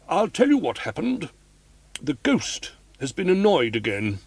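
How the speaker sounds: put-on a homely old boxkeeper, a bit indignant; old boxkeeper woman. A narrator's reading.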